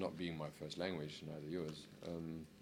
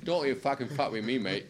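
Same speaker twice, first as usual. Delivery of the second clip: put-on higher, louder London accent, playful menace; mock cockney threat; 7 semitones higher, 8 dB louder than usual. Imitating a London lad squaring up to a mate, mock cockney accent. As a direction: bark it like a cockney bloke picking a fight, tongue in cheek. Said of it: "especially in certain parts of London".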